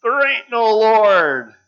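loud, high, slow angry shout. Acted. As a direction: bellow it back at her. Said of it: "he would shout".

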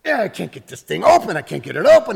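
a hint of a flustered shop kid; frustrated kid. Voicing the kid with a wrench.

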